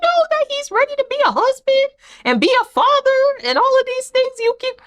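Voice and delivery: high-pitched, disbelieving squeal; mock-baffled falsetto retort; incredulous questioning.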